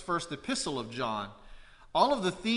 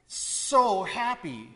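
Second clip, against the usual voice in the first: "so" stretched and heightened, higher pitch; gushing, long emphatic "so"; stress on SO; 4 semitones higher, much slower than usual. Shown as a demonstration. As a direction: draw out "so" for emphasis. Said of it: "we would heighten something".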